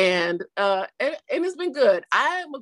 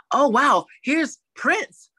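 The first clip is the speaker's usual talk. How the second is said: starstruck excitement; 5 semitones higher, 5 dB louder, much slower than usual. Acted. Acts out excited viewer.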